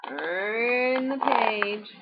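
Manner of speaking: very slow, stretched page-turn call; slow, teasing singsong prompt; drawn-out cue. Real, not acted. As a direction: stretch the first word right out.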